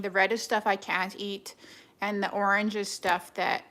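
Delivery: normal talking voice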